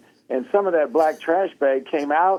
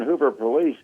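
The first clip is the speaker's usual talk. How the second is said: ordinary talk, nothing marked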